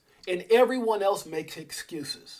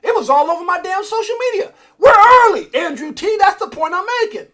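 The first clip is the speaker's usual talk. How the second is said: shouting, much higher and louder; angry shout; 13 semitones higher, 14 dB louder than usual. Retold or imitated. Answering family who got mad, furious shout. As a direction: bellow it back in frustration. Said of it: "I'm like".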